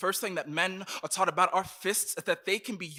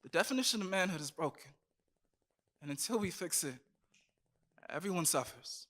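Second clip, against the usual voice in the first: quiet; 8 dB quieter than usual. Acted. Quiet closing lines.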